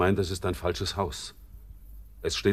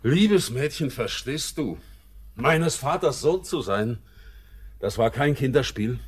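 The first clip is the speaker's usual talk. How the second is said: anguished outburst; 6 semitones higher, 4 dB louder than usual. Acted. Anguished outburst about his father.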